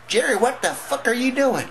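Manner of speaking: mama shrieking. Acted. Mama bursting in on her son, shrieking high. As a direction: catch him in the act and scream it out.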